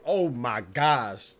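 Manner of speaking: drawn-out, loud amazed gasp of words. Real, not acted. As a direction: stretch it out in awe at the pass. Amazed at a great pass.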